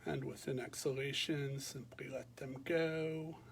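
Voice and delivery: calm, unhurried meditation voice with long pauses; guided meditation. Real, not acted. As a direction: guide listeners gently through a body scan, leaving space after each phrase. Leads a body scan meditation.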